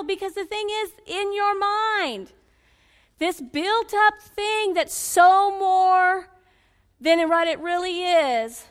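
high, ringing preacher's declaration; forceful preaching. Real, not acted. Preacher telling women who is after them, forceful preaching. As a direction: preach it with rising fervour, stretched key words.

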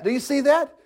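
ordinary talk

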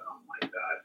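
breathless whispered gasp of dread; whispered panic. Retold or imitated. Panicked thought at seeing boss.

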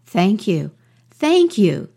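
thrilled mouse, second thanks leaping up in pitch; grateful excitement. A narrator's reading. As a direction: let the gratitude swell on the repeat. Mouse thanks frog for new name.

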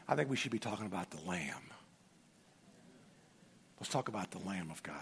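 drops to a low, soft hush; hushed, reverent. Real, not acted. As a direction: let it sink in quietly, like a secret worth keeping. Pointing the church to the Lamb.

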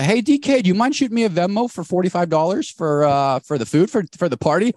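a hint of an entitled host asking for cash; party host's request. Host casually asks a guest to pay up, lightly mimicked.

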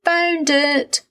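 put-on high-pitched, happy child calling out. A narrator's reading. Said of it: "little Ted called out happily".

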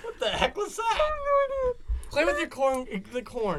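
laughing. Real, not acted. Reacting to laughing fit.

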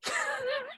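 sudden choking cough; coughing fit. Real, not acted. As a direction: cough as food goes down the wrong pipe. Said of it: "Sorry, no, uh, that was I, I ate something, went down the wrong pipe".

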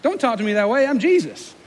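high-pitched, affronted brush-off; ungracious mock retort. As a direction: play an indignant, self-important Jesus. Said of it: "he's so much more gracious than we are. I'd have been like".

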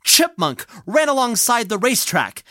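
ordinary delivery

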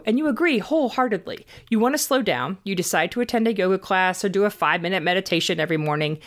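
usual speaking voice

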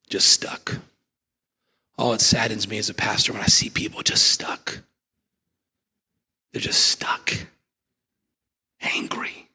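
hushed, sorrowful whisper; sad whisper. Real, not acted. Pastor grieving over stuck people, sad whisper. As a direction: breathe it out in grief. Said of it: "sad pastor whispering".

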